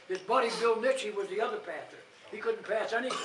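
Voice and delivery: usual speaking voice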